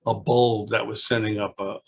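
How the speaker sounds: ordinary delivery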